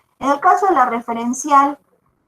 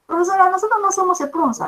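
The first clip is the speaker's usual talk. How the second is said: voz de alumno imitada, más aguda y rápida; alumno que protesta; 9 semitones higher, faster than usual. Retold or imitated. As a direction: imita a un alumno que reclama, sin exagerar. Teacher voices a student's objection. (una alumna le reclama a la profesora, aguda y rápida)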